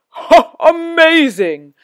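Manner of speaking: loud, slow astonished rumble; amazed Gruffalo. Acted. Gruffalo marvels at the mouse, loud and slow. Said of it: "said the Gruffalo".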